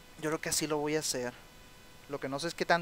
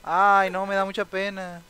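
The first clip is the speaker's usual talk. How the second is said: pitying; 3 semitones higher, 7 dB louder, slower than usual. Real, not acted. His sim wife is crying.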